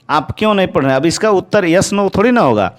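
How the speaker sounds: ordinary talk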